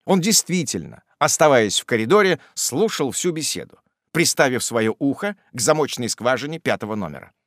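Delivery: usual speaking voice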